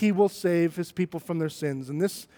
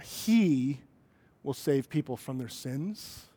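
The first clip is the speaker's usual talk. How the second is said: "he" held long, then the rest softer; stress on HE; 6 semitones lower, 6 dB quieter, slower than usual. Shown as a demonstration. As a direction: lean on "he" and pause after it. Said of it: "put some different emphasis".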